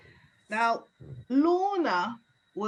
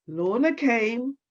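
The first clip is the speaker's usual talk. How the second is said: ordinary delivery, nothing marked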